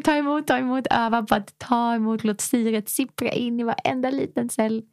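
soothing breathwork guide; guided breathing. Acted. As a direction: lead them through the breath gently. Leads a breathing exercise.

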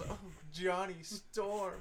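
very slow, wondering delivery; slow drawn-out recognition. Retold or imitated. Fan imagining a reunion, slow mock awe. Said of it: "It was".

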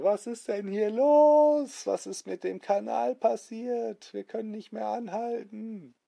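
panic, the canal gone wild; panicked passengers. Acted. Passengers panic at big ship. (Passagiere in Panik, hoch und langsam)

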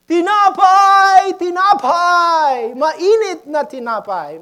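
loud, high, drawn-out street vendor call; long-held hawker's shout; bread seller's cry. Retold or imitated. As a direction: holler like a bread seller waking the village. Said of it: "a noise coming from this guy selling".

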